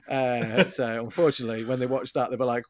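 ordinary talk, nothing marked

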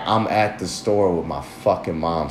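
low embarrassed inner mutter; low muttered thought. Retold or imitated. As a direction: grumble it under your breath, embarrassed. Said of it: "I was there like".

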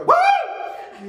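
high, loud shout of praise; joyful whoop. Retold or imitated. Mom whooping praise at six in the morning, loud and high. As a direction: let out a big happy whoop. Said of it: "I hear her go".

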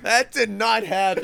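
loud, outraged denial; loud protest. Real, not acted. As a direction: shout it down, laughing it off. Indignant denial of friend's story.

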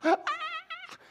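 squealing mock scream; imitated gym scream; high pained cry of effort. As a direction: wail like the last rep hurts. Friend in pain lifting.

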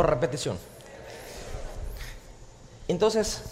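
ordinary talk, nothing marked

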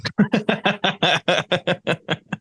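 long chuckling run of laughter; real amused laugh. Real, not acted. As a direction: laugh helplessly at a friend's predicament. Co-host laughs at the story.

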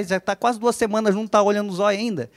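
usual speaking voice

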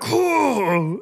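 imitated child's wail; high put-on "oh" sob; mock crying. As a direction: pretend to burst into childish tears. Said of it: "he started crying like".

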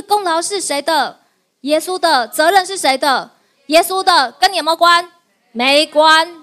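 rally the crowd with each answer; preacher's loud call and reply; call and response. Real, not acted.

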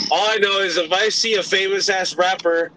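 ordinary delivery

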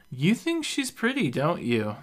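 a hint of a drunk teasing question; tipsy lady. A narrator's reading. Drunk Beth teasing Reuben about the queen, tipsy. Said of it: "the lady asks".

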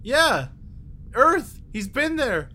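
high, eager rush of hope; light up at news of home; excited. Acted.

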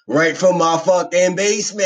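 high, loud hype; hyped up. Real, not acted. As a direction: pump up the intro. Hyped podcast intro.

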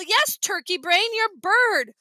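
drawn-out, mildly impatient reply; exasperated retort. A narrator's reading. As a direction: spell it out for a silly little brother. Exasperated brother answers Fudge in chase.

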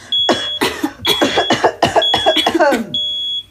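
long loud coughing in smoke; hacking cough. Acted. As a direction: cough hard, choking on smoke. Smoke fills the studio.

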